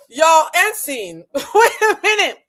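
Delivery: slow words through loud laughter; laughing. Real, not acted. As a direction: drag the words out while cracking up.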